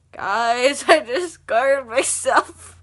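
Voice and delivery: slow, loud wail to the viewers; drawn-out, loud dismay; loud exclamation. Real, not acted. Exclaims again at own mishap.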